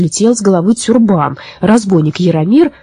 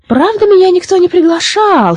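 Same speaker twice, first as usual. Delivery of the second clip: sly smile; 12 semitones higher than usual. A narrator's reading. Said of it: "хитро улыбнулась лиса".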